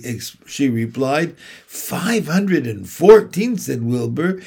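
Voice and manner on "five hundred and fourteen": slow, high astonished echo. A narrator's reading. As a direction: echo the number in disbelief. Wilbur astonished.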